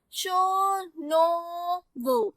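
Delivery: "शो, नो, वो" each held long on the final o; drawn-out rhyme sounds. Shown as a demonstration. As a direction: stretch the vowels in "शो, नो, वो". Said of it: "इसकी sound same हो गई है".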